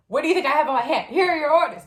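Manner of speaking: loud, high mock exasperation; retold exasperated yell; exasperated instructor.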